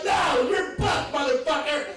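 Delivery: high, drawn-out comic yell; mock shouted answer. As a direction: act out an exuberant caller, stretching the words high. Acts out caller's emphatic answer.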